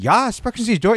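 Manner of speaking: high mock German; German reply. Imitating shipmate Goot answering in German, high mock German. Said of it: "He's like".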